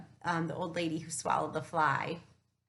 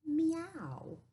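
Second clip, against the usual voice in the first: mock cat meow; 8 semitones higher than usual. George makes cat sound.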